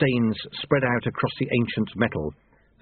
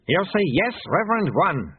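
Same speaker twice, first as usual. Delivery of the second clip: higher-pitched, understated growl; mildly growled prompting; growled order; 8 semitones higher than usual. A narrator's reading. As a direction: press the apprentice to obey. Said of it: "he growled".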